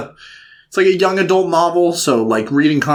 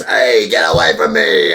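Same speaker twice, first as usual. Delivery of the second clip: put-on lower, brusque mother brushing a kid off; gruff mom; 11 semitones lower than usual. The mother snapping at her begging child, mock brusque. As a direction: shove the kid away with the voice. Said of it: "She goes".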